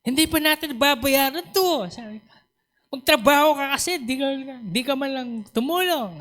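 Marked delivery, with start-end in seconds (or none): 0.1-1.8 high-pitched mock nagging; acted marital squabble. Preacher voices quarrelling spouses arguing over debts, mock-exasperated. Acts out quarrelling married couple. 2.9-6.2 high, hammed-up nagging; acted spousal scolding. Preacher acts out a spouse demanding help with work, mock scolding. Acts out quarrelling married couple.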